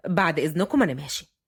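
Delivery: low-pitched male figure, even; young man's voice. A narrator's reading. Fahd answers his grandfather, low and even.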